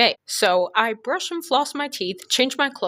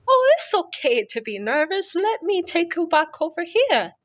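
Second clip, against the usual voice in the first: high mock Eastern European accent; 10 semitones higher than usual. Surgeon calming nervous patient, high Eastern European accent. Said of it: "Eastern European accent".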